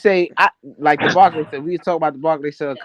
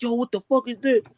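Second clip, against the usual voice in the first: startled, higher exclaim; surprised; 9 semitones higher, 6 dB quieter than usual. Retold or imitated. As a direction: act caught off guard. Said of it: "and like".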